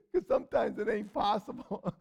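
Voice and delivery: amused aside with real laughter; laughing. Real, not acted. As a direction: chuckle while admitting it. Preacher laughs at his own aside.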